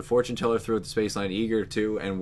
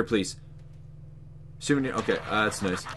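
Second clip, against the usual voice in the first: normal talking voice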